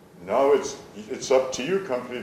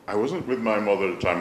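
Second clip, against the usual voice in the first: ordinary talk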